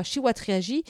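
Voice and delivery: plain reading voice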